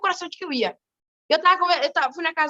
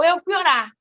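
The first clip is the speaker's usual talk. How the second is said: usual speaking voice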